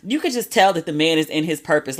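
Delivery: normal talking voice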